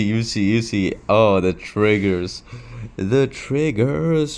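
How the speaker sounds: normal talking voice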